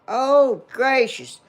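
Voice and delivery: loud, high surprised gasp of words; startled exclamation. Real, not acted. Grandmother suddenly remembers Cody leaving, startled outburst.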